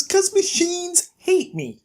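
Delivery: high, drawn-out mock whine; retold complaint. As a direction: voice a put-upon excuse, stretched and high. Retold grumbling excuse about computers.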